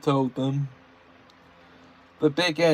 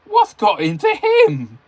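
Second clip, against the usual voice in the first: high, loud, puzzled exclaim; surprised; 9 dB louder than usual. A narrator's reading. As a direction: sound amazed and baffled. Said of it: "asked Edward in great surprise".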